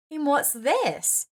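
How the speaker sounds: slow, high, curious ask; surprised question. A narrator's reading. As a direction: lift it in puzzled wonder. Mia surprised by box.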